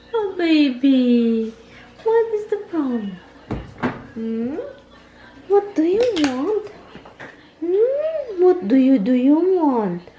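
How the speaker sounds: high, drawn-out sing-song cooing. Real, not acted. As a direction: coo at a little one with swooping pitch. Cooing questions at a baby or pet.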